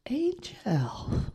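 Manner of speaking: slow, hushed late-night radio voice; breathy near-whisper for the late show; midnight voice. Real, not acted.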